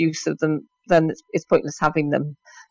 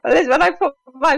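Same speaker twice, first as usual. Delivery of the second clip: laughing; 9 semitones higher, 4 dB louder than usual. Real, not acted. Laughs at joke about face.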